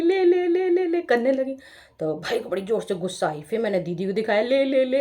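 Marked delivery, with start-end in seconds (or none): 0.0-1.0 high, loud sing-song taunt. Retold or imitated. Girl taunting her brother with her food, high and loud sing-song. 4.4-5.0 taunting. Retold or imitated. Teasing her sister.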